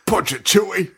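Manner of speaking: high, hammed-up space-pilot command; Han Solo. Imagining Englund reading Han Solo lines, hammy. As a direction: belt out a silly audition line. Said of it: "read Han Solo lines".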